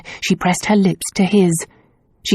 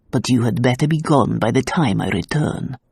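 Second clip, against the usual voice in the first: gruff; 5 semitones lower than usual. A narrator's reading. Gendarme ordering Tazia, gruff and low. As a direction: growl the order down at her.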